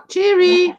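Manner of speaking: put-on high "Cheery" to coax a toddler. Shown as a demonstration. Dad coaxing toddler to say Cheery, high and coaxing. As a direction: pitch it up for the child. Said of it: "Say, uh".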